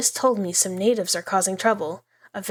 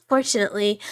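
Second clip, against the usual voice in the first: ordinary delivery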